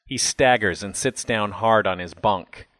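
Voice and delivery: usual speaking voice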